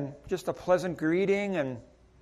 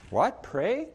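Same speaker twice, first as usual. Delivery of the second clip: rising, puzzled mock surprise at the word Pray; hammed-up puzzled reaction; surprised echo; 4 semitones higher than usual. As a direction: toss back the word in disbelief. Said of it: "I'm like".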